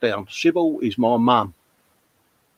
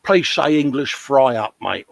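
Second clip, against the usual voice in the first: usual speaking voice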